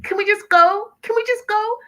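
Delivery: mock panicked begging; desperate pleading. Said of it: "She's like".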